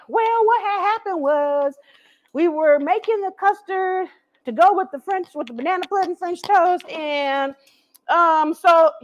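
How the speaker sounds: high, slow sheepish explaining; fumbling excuse. Retold or imitated. Cook acts out a flustered excuse for poisoning a guest, high sheepish stammer. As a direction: stall like someone caught out. Mock excuse told to someone.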